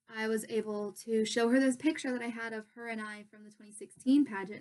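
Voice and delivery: normal talking voice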